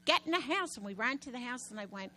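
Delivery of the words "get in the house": higher and louder urgent order; urgent yell. Retold or imitated. Wife ordering Carrie into the house, yelling. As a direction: yell at her to get inside. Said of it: "And I said".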